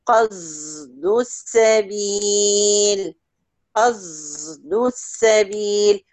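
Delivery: قصد said with the sad sounding near a z; slow قصد with a z-tinted sad; sad blended with z. Shown as a demonstration. Teacher demonstrates Hamza's reading slowly to her student. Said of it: "بإشمام هنا بصوت حرف الزاي".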